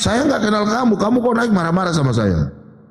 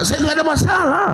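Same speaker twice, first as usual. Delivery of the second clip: shouted, pitched much higher; 8 semitones higher than usual. Real, not acted. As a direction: shout to make the host listen. Protesting loudly to host.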